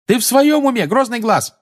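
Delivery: пронзительно высокий громкий крик; заори на командира очень высоким голосом; возмущённый ор. A narrator's reading. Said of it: "заорала Тонкс". (орёт в возмущении)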